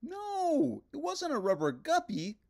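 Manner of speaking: high, bright puppet voice; cheerful little puppet; Ernie voice. A narrator's reading. Narrator voicing Ernie answering the phone, high puppet voice.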